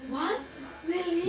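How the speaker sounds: high, loud disbelief; wife shocked to hear he also moves hands; real surprise. Real, not acted. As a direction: react in startled disbelief.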